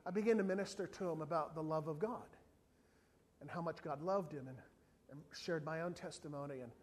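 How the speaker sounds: ordinary talk, nothing marked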